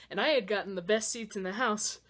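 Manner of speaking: ordinary talk, nothing marked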